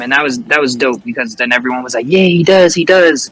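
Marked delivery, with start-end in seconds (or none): 2.1-3.3 loud, eager agreement; crowd chiming in. Retold or imitated. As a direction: back him up like an excited crowd.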